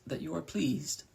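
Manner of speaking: lightly voiced seductive crow maiden; alluring feminine voice. A narrator's reading. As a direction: lure him in gently with a lilt. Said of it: "said an alluring feminine voice".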